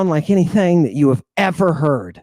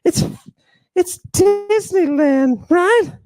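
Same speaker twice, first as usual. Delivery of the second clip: much higher, slow, drawn-out mocking voice; mock surfer dude; slower than usual. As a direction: play Jesus as a clueless dude. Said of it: "You think he's like".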